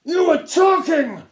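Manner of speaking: high, loud, slow bark; captain barking at pupil; barked accusation. A narrator's reading. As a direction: snap it like a drill sergeant. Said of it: "he barked".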